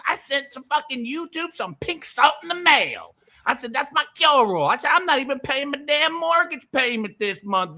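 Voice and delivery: high-pitched hammed-up country rant. Swearing by pink salt to Vic, high excited country voice. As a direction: crow it like a worked-up country huckster. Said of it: "I'll do it in the country voice now".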